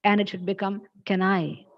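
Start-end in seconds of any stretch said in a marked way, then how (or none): 1.1-1.7 "Can" and "I" joined quickly as one word; linked words. Shown as a demonstration. Teacher showing how the question should become, words linked. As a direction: club "Can I" together, then land on "go". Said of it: "This can and I can be clubbed together".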